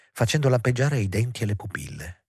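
usual speaking voice

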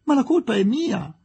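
high, earnest insistence; insistent. A narrator's reading. Aziz blaming himself to the ladies, insistent. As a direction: insist on taking the blame. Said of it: "insisteva Aziz".

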